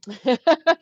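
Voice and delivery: short, loud burst of laughter; amused laugh. Real, not acted. Streamer laughing after own joke song, amused laugh.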